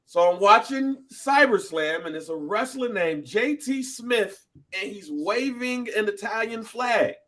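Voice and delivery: high-pitched mock-lecture proclamation; dramatic fact announcement. Presents a history fact.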